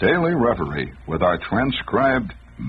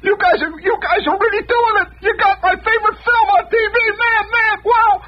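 a lightly voiced thrilled caller, much higher; excited caller; 4 dB louder than usual. A caller thanking the station, lightly voiced and thrilled. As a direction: imitate a delighted guy on the phone. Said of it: "some guy says".

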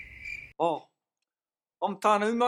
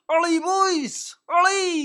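cheering; 6 semitones higher, much slower than usual. Acted. Cheering players onto field.

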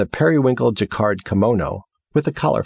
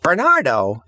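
calling out; 8 semitones higher than usual. Acted. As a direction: call for him to show up. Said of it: "she called".

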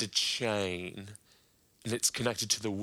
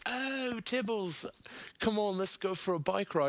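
high, breezy impersonation of a chummy Brit; Russell Brand impression; 8 semitones higher than usual. Russell Brand inviting his friend on a bike ride, high chummy impression. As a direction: coax a friend along, pitched up and airy. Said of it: "Russell Brand came over and he was like".

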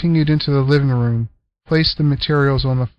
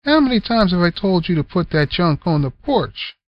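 chiding; 6 semitones higher than usual. Acted. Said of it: "chided Selma".

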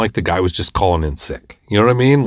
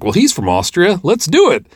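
high, louder, eager go-ahead; excited approval; 10 semitones higher, 4 dB louder than usual. Retold or imitated. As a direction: jump at the idea. Retold enthusiastic approval.

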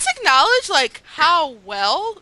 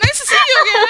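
high, loud, prim mock-polite greeting; polite Zuko; 12 semitones higher, 6 dB louder than usual. Mimicking Zuko greeting Suki, overly polite. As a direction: greet her like a very well brought up boy.

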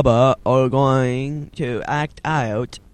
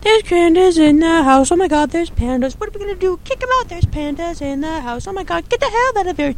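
very high, squeaky frantic voice; mock panic; 3 dB quieter than usual. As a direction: squeal it like a panicking little cartoon figure. Mock panic about pandas in house.

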